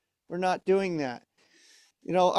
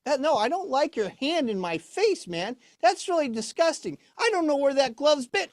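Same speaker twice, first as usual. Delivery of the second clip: high-pitched puppet complaint; squeamish, high comic objection; disgusted puppet Orb; 8 semitones higher than usual. Acted. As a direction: protest in a raised puppet voice. Puppet objects to hand over face.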